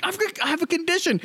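high defensive cartoon voice; imitated high-voiced cartoon oddball; Mister Crocker. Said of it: "And then he was like".

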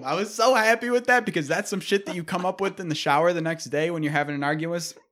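ordinary talk, nothing marked

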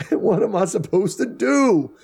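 high, slow imitation of wailing; awful mock whine. Imitating him pleading over his medical card, mock wailing. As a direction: whine it helplessly, hammed up. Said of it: "he just does this awful, like".

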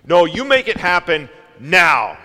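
angry demand. Retold or imitated. Israelites loudly demanding Moses act now. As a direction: shove the demand at him. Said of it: "the argument kinda went like this".

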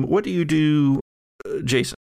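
usual speaking voice

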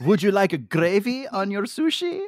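mock a Quebecois waiter with a clumsy Quebec accent; bad Quebec accent. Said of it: "I can't do a Quebec accent".

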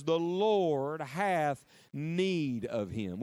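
very slow, each word weighed; slow solemn reading. Shown as a demonstration. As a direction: read it slowly like scripture. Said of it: "underline those six little words".

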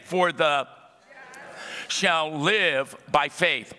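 slow repeat with a pause after "For the", stress on "live"; slow emphatic repeat. Shown as a demonstration. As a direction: say it again slowly, pausing after "For the". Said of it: "I want to say that again".

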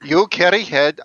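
usual speaking voice

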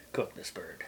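whisper. Pretend. Joking threat to cook bird.

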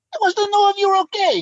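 put-on high-pitched Italian-accented English; imitated excitable Italian friend. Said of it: "he comes back, and he says".

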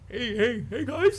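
rising, hammed-up locker-room hello; mock old man greeting. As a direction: hail the guys like an oblivious old man.

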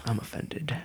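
mock-offended whisper. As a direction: breathe it like a wounded confession.

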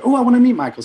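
high, loud, eager exclamation. Retold or imitated. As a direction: imitate a friend jumping at an introduction. Quotes eager friend wanting introduction.

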